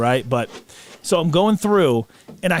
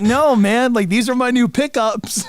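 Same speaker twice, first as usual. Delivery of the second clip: higher-pitched, flustered explaining; exasperated, raised-pitch protest; pleading protest; 7 semitones higher than usual. Retold or imitated. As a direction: imitate yourself pleading with a guard. Retold protest to the guard.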